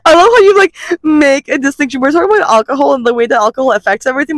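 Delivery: ordinary talk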